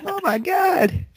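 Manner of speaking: very high, drawn-out squeal; mock screaming girl. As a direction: gush like an overexcited roommate. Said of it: "screaming and, like, broadcasting your life".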